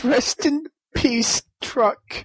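put-on hillbilly accent. Mock eulogy in accent.

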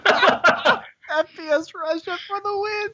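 mock catchphrase. Host jokes with a gamer catchphrase. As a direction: shout out a gamer catchphrase in a put-on voice.

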